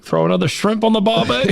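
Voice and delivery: high, hammed-up Australian accent. Host jokes to Australian listeners in a hammed-up Australian accent. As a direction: toss off a cartoon Aussie line in a broad Australian accent. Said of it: "I didn't do it good".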